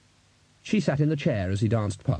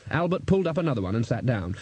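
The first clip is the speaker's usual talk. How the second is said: plain reading voice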